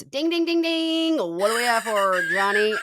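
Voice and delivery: high sing-song ding ding; game show host bell voice, mocking; Jim Carrey impression. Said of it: "Jim Carrey goes".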